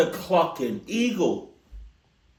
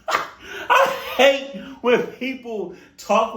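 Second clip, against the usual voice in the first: words broken by real laughter; can't stop laughing while speaking; slow, laugh-choked speech; 5 semitones higher, slower than usual. Real, not acted. Cracking up mid-sentence.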